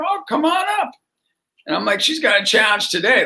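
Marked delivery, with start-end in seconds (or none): 0.0-0.9 welcoming call. Retold or imitated. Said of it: "I go".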